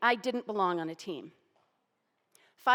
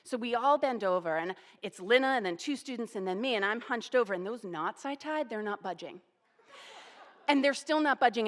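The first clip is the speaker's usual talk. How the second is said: ordinary delivery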